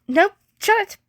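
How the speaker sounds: high, loud, snapping him off; sharp shutdown. A narrator's reading. Prince silencing Patton's interruption, in a sharp, loud, high snap. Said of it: "shot him down again".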